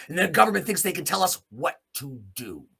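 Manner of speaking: ordinary talk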